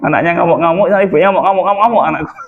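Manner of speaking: tickled, laughing anecdote; amused telling. Real, not acted. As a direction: tell it while chuckling, pitch up. Laughing while telling child's tantrums.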